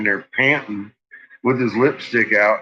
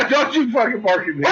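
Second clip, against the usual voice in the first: laughing scold; 10 semitones higher than usual. Real, not acted. Laughing scold of barking dog.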